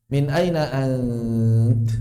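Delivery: aina stretched very long and slow; slow lengthened aina; drawn out. Shown as a demonstration. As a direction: hold the vowel of aina long, Quran-style.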